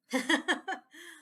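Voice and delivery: short amused laugh; real burst of laughter. Real, not acted. Laughs at own remark.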